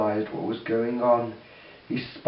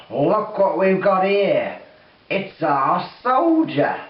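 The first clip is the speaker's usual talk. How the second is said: loud, slow, higher demon gloat; 5 semitones higher, 4 dB louder, slower than usual. Acted. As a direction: savour the catch, slow and loud. Demon taunts the soldier.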